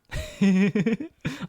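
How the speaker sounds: loud high rueful laugh. Real, not acted. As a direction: laugh off the loss. Laughing after near miss.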